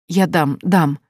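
usual speaking voice

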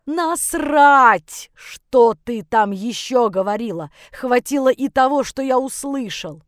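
loud angry outburst, easing toward the end; yelling. Acted. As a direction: start on a high roar, then push on angrily. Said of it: "заорал я". (орёт со злостью, начиная с крика)

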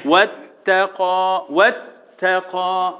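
slow واتقى, leaning into the doubled taa; واتقى with a long, firm double t; stressed shadda. Shown as a demonstration. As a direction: hold the taa closure in واتقى, then release. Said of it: "اشد التاء وانا معدي عليه".

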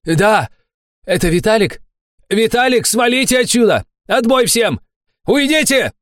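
high, urgent yelling; barked shout. A narrator's reading. Fat man orders his men to leave, shouting. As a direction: holler to send them all away. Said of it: "гаркнул толстяк".

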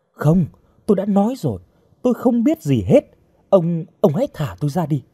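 high-pitched pleading denial; insistent protest. Acted. Captive girl begs her accuser to free her, insistent protest. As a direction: protest urgently, then plead.

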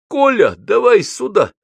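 high, loud, drawn-out call; shouting. A narrator's reading. Аксаков calls Гоголь over to his table, shouting. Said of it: "закричал Аксаков".

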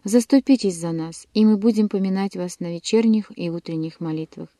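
usual speaking voice

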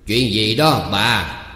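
loud, drawn-out holler to the wife; calling through the house. A narrator's reading. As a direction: call out loudly, rising on the second call. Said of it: "ứng tiếng hỏi lớn".

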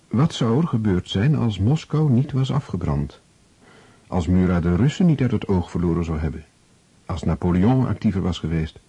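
usual speaking voice

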